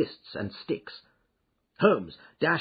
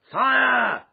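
loud, high, drawn-out shout; cry of alarm; 13 semitones higher, 10 dB louder than usual. A narrator's reading. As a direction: yell a long alarm across the room. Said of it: "with a cry of".

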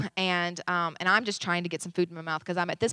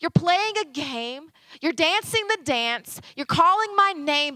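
high, slow, aching challenge; God confronting a complacent church, exasperated; exasperated rebuke; 8 semitones higher, 3 dB louder, slower than usual. Retold or imitated. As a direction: plead with them to wake up. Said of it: "the Spirit of God would say".

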